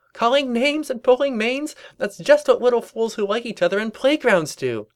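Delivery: high-pitched teasing sing-out; gleeful Pinkie. Acted. Pinkie teasing the rivals, high gleeful chatter. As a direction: tease them in a high cartoon voice.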